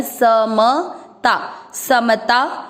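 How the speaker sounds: समता as sa-ma-ta with every a full; समता with the inherent a kept in each syllable; full vowels. Shown as a demonstration. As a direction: say समता slowly, never as सम्ता. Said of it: "दोनों अक्षर पूरी, दोनों में अ mix करना है".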